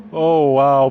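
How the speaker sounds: usual speaking voice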